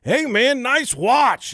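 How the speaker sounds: high, loud mock holler; calling out. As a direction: holler it across the room. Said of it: "I'll go".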